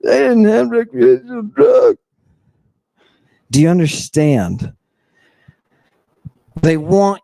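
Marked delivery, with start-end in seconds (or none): 0.0-2.0 higher, shouted parody of the doubters; mock skeptic raised to a shout. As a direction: yell it back at them in a silly mock voice. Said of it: "all those people who are like".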